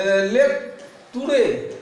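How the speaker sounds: लेतूरे said slowly as lay-too-ray; slow mock misreading. Shown as a demonstration. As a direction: drag out लेतूरे like a beginner. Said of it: "ऐसा पढ़ेगा जो नहीं जानता".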